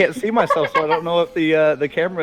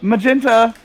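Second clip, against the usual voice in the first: called out higher, a bit louder; hollered name; 7 semitones higher, 4 dB louder than usual. Acted. Game master voices a character summoning someone, hollering. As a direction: holler it across the house. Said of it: "you hear him holler".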